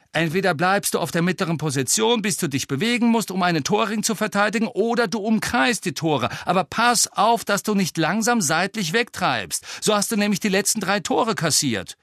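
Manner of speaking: high, insistent coaching; urgent high-voiced telling-off; captain's lecture. A narrator's reading. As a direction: drill the instructions in, pitched high. Captain lectures keeper across pitch.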